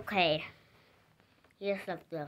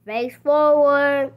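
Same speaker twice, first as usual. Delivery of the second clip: loud command; 4 semitones higher, 11 dB louder, slower than usual. Pretend. Giving command to toy.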